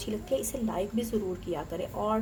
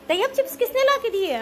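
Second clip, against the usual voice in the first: calling out; 12 semitones higher, 8 dB louder than usual. Real, not acted. Mother calls to small son.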